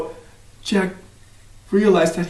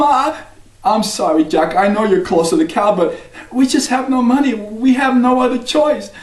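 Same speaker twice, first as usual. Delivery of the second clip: a hint of a higher, regretful mother; sorry mother; 7 semitones higher than usual. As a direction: gently let him down. Mother apologizes to Jack.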